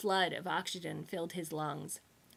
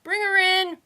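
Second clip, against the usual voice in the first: higher, louder relayed call; 12 semitones higher, 13 dB louder than usual. A narrator's reading. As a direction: pass the order down the line. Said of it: "relayed a voice".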